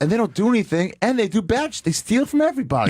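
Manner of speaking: high-pitched, worked-up griping; indignant rant. Real, not acted. Ranting to the host about airport screeners, indignant. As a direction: rail at them, pitched well up.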